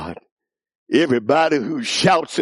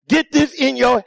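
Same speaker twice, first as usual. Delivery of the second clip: telling your neighbour; 10 semitones higher than usual. Pretend. Congregation member telling a neighbour, emphatic. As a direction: lean to your neighbour and press it. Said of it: "look at somebody and tell 'em".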